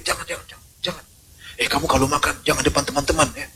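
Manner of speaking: a secretive whisper of a stingy parent; hushed stingy parent. Acted. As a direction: hush the child so no one hears. Stingy parent hushing the child.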